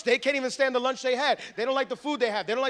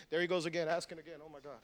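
low, muttered grumble under the breath; grumbling; 7 semitones lower, 13 dB quieter, faster than usual. Acted. Churchgoer grumbling about being asked to give, low muttering. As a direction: mutter it quietly, fed up. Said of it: "don't do it grumbling and arguing".

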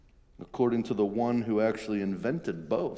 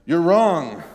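"You're wrong" shouted higher and louder; loud, higher accusing "wrong"; harsh rebuke; 6 semitones higher, 11 dB louder than usual. Shown as a demonstration. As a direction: snap it at him as a rebuke. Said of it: "is what Peter is telling him".

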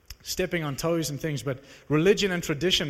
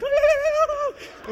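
put-on much higher, louder kids' gleeful la la la; imitating running kids; 7 dB louder, slower than usual. Preacher imitating children celebrating in the streets, high gleeful squeal. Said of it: "Kids just started running, like".

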